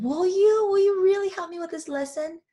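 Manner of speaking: high, loud, eager plea; can't believe its luck; overjoyed little soul. Acted. Little soul begs the other soul for help, high and overjoyed. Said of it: "The little soul was so happy".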